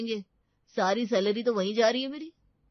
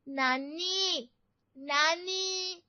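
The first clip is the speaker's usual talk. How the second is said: put-on drawn-out child call for nani; calling out to grandma; 6 semitones higher, much slower than usual. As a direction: stretch it out calling nani from another room. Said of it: "चिल्ला चिल्ला कर अपनी नानी को बुलाने लगता है". (नानी को पुकारता बच्चा, खिंची हुई आवाज़)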